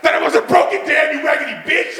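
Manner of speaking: high, shouted reenacted accusation; indignant outburst at dad. Son confronts dad after the diagnosis.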